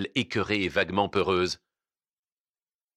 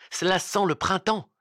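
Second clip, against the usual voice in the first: put-on bright-spirited girl's reply, pitched high; cheerful; 9 semitones higher than usual. A narrator's reading. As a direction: lift it gaily, much higher. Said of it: "répondit gaiement la jeune fille".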